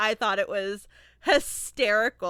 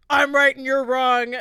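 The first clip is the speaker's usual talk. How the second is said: louder, confident macho imitation; masculine voice; 4 semitones higher, 7 dB louder than usual. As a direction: mimic a know-it-all man insisting. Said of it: "this masculine".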